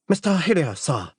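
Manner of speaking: high announcing voice, dropping on "sir"; formal butler. Acted. Butler showing a guest in to his employer, high formal voice.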